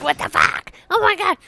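high, loud mock rage at being knifed; imitating enraged gamers; mock raging players. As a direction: ham up the angry players' outburst, high and squawky. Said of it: "They're just like".

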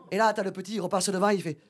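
usual speaking voice

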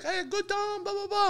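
high, soft imitation of his wife's broken English. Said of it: "Sounded like Melania Trump".

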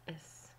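S as a soft hissing sound; isolated hiss. Shown as a demonstration. Teacher to child, sounding the letter S alone. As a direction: breathe out a quiet hiss for S. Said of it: "J'enlève la voyelle".

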